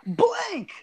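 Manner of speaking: breathy whispered word. Acted. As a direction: whisper it dramatically. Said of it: "I like the way you read that".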